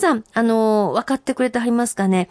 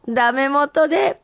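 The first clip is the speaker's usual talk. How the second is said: senryu recital; 4 semitones higher, slower than usual. Acted. Reading senryu aloud.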